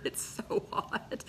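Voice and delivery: breathy whisper, slow. Real, not acted. Host whispering to viewers about heat. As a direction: whisper the complaint, drawn out.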